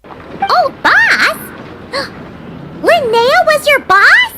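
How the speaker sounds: high-pitched, incredulous question with a gasp; gasp, then ask slowly in high disbelief; shocked disbelief. Acted. Stunned by the nickname.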